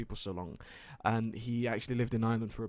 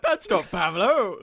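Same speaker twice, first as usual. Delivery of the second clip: surprised; 6 semitones higher, 8 dB louder, slower than usual. Retold or imitated. Retold surprise at wrong person.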